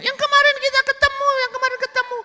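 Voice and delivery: loud, very high falsetto; protesting woman. Acted. Woman to the man who forgot her, protesting falsetto. Woman reminds Burhan insistently.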